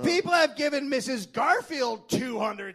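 high, loud proclaiming Charles. Retold or imitated. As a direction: address the courtroom grandly.

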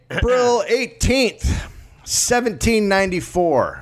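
high, slow, theatrical date reading; hammed-up narrator. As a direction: announce the date like a grand movie trailer. Dramatic date reading, voiced.

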